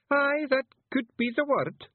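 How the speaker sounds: very high, slow, loud Scots voice; Billy. A narrator's reading. Feegle agreeing with Miss Level, very high and slow. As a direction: draw it out in a piping Scots voice. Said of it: "said Billy".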